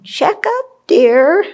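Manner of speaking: high, light voice of a kindly teacher nudging; gentle teacher's reminder. Retold or imitated. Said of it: "my teachers sitting there on my shoulder saying".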